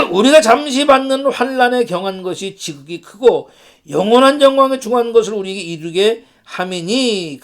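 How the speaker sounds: solemn measured Bible reading; scripture reading. Acted. Pastor reading James to the congregation, solemn. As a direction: read the verses out to the church. Reads verse aloud.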